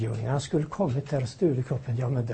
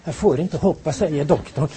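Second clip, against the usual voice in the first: usual speaking voice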